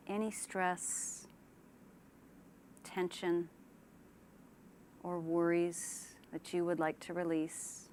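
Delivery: unhurried relaxation-exercise delivery; guided meditation. Shown as a demonstration. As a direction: ease them gently into calm, leaving space after each phrase. Leads a guided imagery relaxation.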